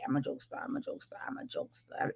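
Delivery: unvoiced whisper to herself; whispered aside. Real, not acted. Assuring viewers she jokes, in a whisper. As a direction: murmur it under the breath. Trailing self-reassurance.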